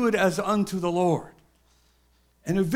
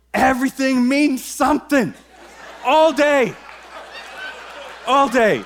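intense prophetic believer; 3 semitones higher, 4 dB louder than usual. Acted. Acting out prophetic people's intensity.

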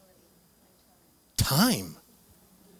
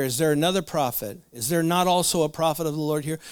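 usual speaking voice

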